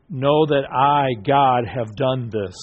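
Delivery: slow, weighty I, drawn out; emphatic I, measured and slow; stress on I. Shown as a demonstration. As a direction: stretch I and say the rest deliberately. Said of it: "put the emphasis on the I".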